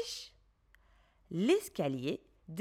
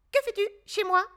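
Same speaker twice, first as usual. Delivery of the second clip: high, loud small rabbit voice; higher, louder rabbit voice, surprised; rabbit character; 11 semitones higher, 4 dB louder, much faster than usual. A narrator's reading. Rabbit voice in story.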